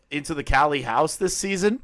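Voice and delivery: usual speaking voice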